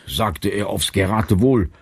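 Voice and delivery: usual speaking voice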